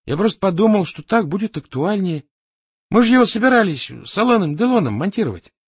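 high-pitched, nervous self-justification; anxious excuse. A narrator's reading. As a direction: plead your case to an angry boss. Employee nervously justifies himself. (оправдываясь, испуганно)

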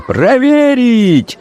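very high, long-drawn yell; frenzied cry. A narrator's reading. Crowd demanding a check of cards, frenzied cry. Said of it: "с неистовством кричали".

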